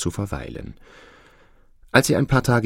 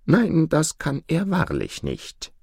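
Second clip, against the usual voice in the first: slow, higher-pitched reassurance; reassuring peacock; 8 semitones higher, slower than usual. Acted. Peacock assuring the goose that man cannot come, reassuring. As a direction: deny it slowly and firmly for a worried friend.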